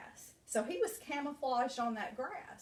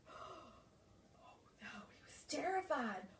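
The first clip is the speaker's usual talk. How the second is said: frightened whisper; scared whisper; 4 semitones higher, 6 dB quieter, slower than usual. A narrator's reading. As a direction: whisper it in fear. Scared cat in jungle.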